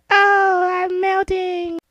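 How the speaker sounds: long, drawn-out wail; melting witch. Retold or imitated. Retelling the witch's end, drawn-out melting cry. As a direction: stretch the words out as you melt away.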